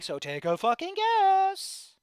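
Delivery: drawn-out, rising exasperated yell. Real, not acted. Streamer venting that nothing ever changes, exasperated. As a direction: stretch it out loud and high, fed up. Venting about unchanged situation.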